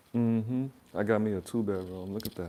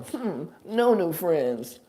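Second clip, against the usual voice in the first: put-on higher, louder, very slow kid voice; hammed-up toddler saying the slogan; little kid; 7 semitones higher, 6 dB louder, much slower than usual. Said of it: "say it like a little kid".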